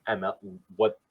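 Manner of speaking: usual speaking voice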